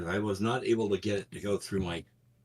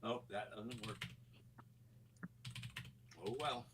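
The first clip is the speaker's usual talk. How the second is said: distant, faint, muttered far off; speaking from another room; in the other room; 21 dB quieter than usual. Real, not acted. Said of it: "you sound like you're in the other room".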